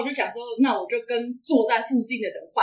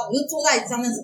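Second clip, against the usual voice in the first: ordinary talk, nothing marked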